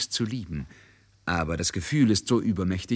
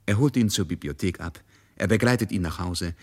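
usual speaking voice

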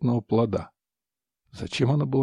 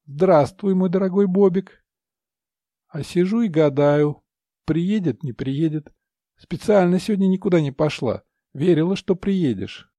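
cooing mistress; 5 semitones higher, 4 dB louder than usual. Acted. Mistress greets him by phone.

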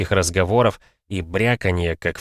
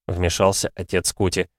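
ordinary delivery, nothing marked

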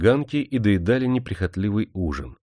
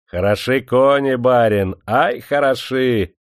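higher, drawn-out admiring exclamation; admiring praise; 4 semitones higher, 4 dB louder, slower than usual. A narrator's reading. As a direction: praise it heartily, stretching the last word. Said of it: "похвалил он".

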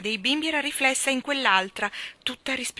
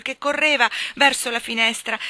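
ordinary delivery

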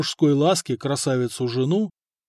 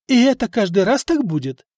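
displeased; 8 semitones higher, 5 dB louder than usual. A narrator's reading. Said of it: "недовольно спросила Эльфрида".

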